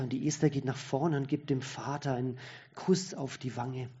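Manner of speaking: ordinary talk, nothing marked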